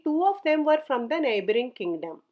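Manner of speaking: ordinary delivery, nothing marked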